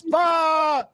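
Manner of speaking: ordinary talk, nothing marked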